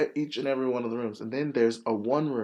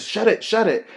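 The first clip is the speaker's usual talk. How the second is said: urgent command; 8 semitones higher, 7 dB louder than usual. Retold or imitated. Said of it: "she says".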